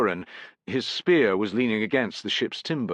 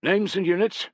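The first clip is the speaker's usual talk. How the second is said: demanding; 10 semitones higher than usual. A narrator's reading. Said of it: "he demanded".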